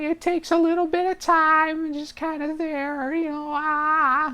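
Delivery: very high, drawn-out character voice; Carl. Acted. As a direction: pitch it way up and drag it out. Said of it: "one of the fun ones ... was just, uh, uh, Carl".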